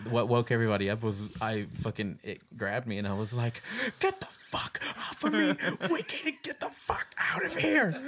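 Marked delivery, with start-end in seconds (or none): 4.0-7.9 very high, strained mock scream; panicked squeal, held back in volume; imitated dream scream. Dreamer yells at the dummy grabbing him, strained mock scream. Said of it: "I was screaming in the middle of the house".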